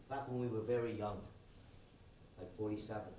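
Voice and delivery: low, soft, unhurried reminiscence with long pauses; hushed, intimate monologue; gentle storytelling. Acted. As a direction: tell it quietly, letting each phrase settle. Tells a story of Agnes.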